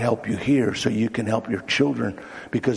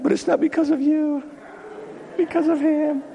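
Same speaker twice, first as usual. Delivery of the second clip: high-pitched, earnest insistence; emphatic correction; 13 semitones higher than usual. Acted. Patient telling psychiatrist it was God, high insistence. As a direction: insist on who deserves credit.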